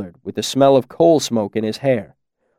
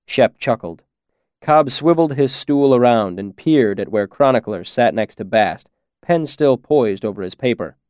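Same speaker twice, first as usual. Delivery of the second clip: ordinary delivery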